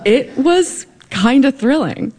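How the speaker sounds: amused and a bit sheepish; laughing admission. Real, not acted. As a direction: confess it with a laugh. Admitting competition excitement with laugh.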